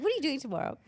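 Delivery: put-on high-pitched retelling of an older woman's question; imitated older French woman. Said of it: "I can't do a French accent".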